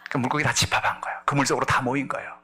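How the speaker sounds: usual speaking voice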